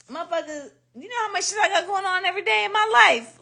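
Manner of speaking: high, laughing exasperation; exasperated retort. Retold or imitated. Snapping back at critics, high and laughing. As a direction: let them have it, half laughing.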